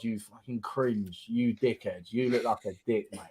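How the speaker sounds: a quiet inner voice telling himself off; half-mocking, hushed self-talk; muttering to himself. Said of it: "having these arguments in my head".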